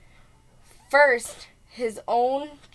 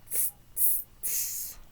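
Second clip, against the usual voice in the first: unvoiced tss sounds; monkey tss hiss; 16 dB quieter than usual. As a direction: hiss back like cheeky monkeys. Monkeys' reply.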